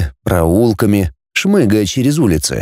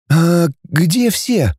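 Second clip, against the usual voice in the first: slow, higher, hesitant question; timid; 6 semitones higher, much slower than usual. A narrator's reading. Girl asks where everyone is, timidly. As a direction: draw it out, timidly afraid to ask. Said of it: "робко спросила Наташа".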